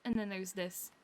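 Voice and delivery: ordinary delivery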